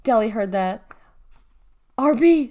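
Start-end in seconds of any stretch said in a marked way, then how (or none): 2.0-2.5 loud, high urgent call; yelled. A narrator's reading. Girl warns her little brother, yelled. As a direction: yell a warning to him. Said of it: "she yelled".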